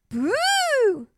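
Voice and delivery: high, drawn-out boo; playful boo. A narrator's reading. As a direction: jump out and boo. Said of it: "said the little princess".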